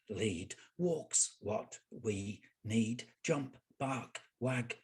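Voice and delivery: one word at a time, evenly punched; dog's poem recitation. Acted. Poet recites Big Dog's Best Poem as the dog, staccato word-by-word delivery. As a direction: deliver each word separately like a list of thoughts.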